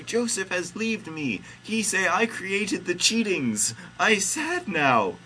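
high-pitched complaining voice, slowing down; high, loud mock lament; Mary yelling. A narrator's reading. Host reading Mary blaming God for Joseph leaving, yelling. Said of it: "yell Mary".

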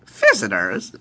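loud bad British exclamation; surprised scientist, hammy British accent. Lab scientist spots guests entering, bad British accent. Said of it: "I'm doing a British accent".

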